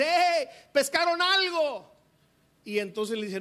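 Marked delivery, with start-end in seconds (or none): 0.0-1.9 high, loud, slow call across the water; shouting from the beach. Acted. Man on shore calls out to the fishermen, loud slow shout. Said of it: "un hombre les grita desde la orilla".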